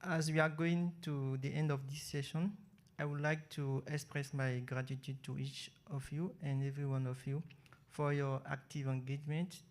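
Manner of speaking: usual speaking voice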